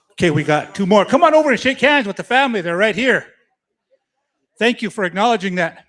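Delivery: loud announcer call-out; powwow emcee. Acted. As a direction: call folks over the PA. Emcee calling people over.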